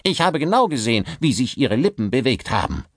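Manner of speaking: furious, fast; angry director. Acted. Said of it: "knirschte vor Wut". (wütend anklagend)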